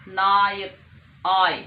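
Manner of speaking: "Nayak", then "आय" called out loud and drawn apart; loud sound demo. Shown as a demonstration. Teacher sounding out "आय" loudly for her students. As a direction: boom out "आय" so the class hears it. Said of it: "आय की sound ध्वनि निकल रही है".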